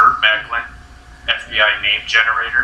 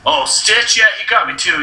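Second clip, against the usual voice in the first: arrested guy voice; 8 semitones higher than usual. Acted.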